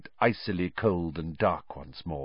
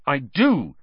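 fierce; 5 semitones higher, 5 dB louder than usual. A narrator's reading. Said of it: "said Harry fiercely".

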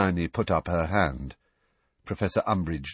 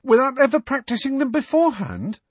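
very high, louder disbelieving question; astonished schoolgirl protest; incredulous; 5 dB louder than usual. A narrator's reading. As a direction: ask in high-pitched disbelief. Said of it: "said Parvati incredulously".

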